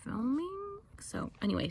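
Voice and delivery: whispered aside. Real, not acted. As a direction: drop to a whisper. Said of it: "sorry, I wanna check".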